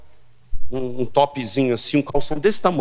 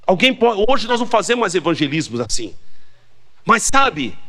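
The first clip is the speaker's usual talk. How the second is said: usual speaking voice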